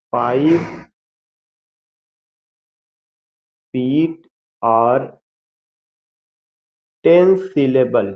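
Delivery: slow dictation with long gaps. Acted. Teacher dictates the fourth point to students, slow dictation. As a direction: leave writing time after each word.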